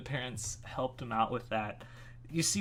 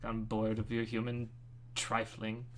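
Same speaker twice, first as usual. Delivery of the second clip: softer, mildly disdainful boredom; Cassius, bored; 7 dB quieter than usual. Acted. Bored wizard dismissing humans, softly unimpressed. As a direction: let it sink into weary disinterest. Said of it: "He'd be, like, bored".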